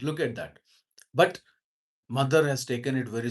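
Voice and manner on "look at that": usual speaking voice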